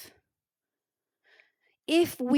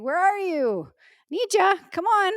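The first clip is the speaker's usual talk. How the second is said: higher, louder, eager "Where are you? Need ya? Come on."; calling a friend; 7 semitones higher, 3 dB louder than usual. Shown as a demonstration. Preacher showing how to call on God as a friend, eager and higher. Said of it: "like I would call a friend".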